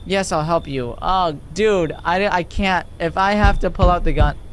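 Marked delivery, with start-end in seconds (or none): none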